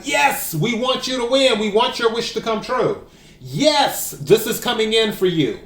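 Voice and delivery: keen, high encouragement; enthusiastic. Acted. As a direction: voice the card's excited yes. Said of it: "That's an enthusiastic".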